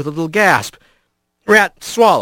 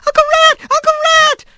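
put-on very high, eager mouse-child cries; squeaky yelling; 3 dB louder, faster than usual. A narrator's reading. Little mice grabbing Rat's arms, squeaky yelling. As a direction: squeal the name in excitement. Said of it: "they were all yelling".

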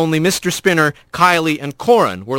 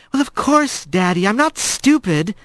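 put-on higher, sulky girl voice; defiant daughter; 6 semitones higher than usual. A narrator's reading. Daughter answering her father, higher and sulky. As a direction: play innocent to her father. Corin voice.

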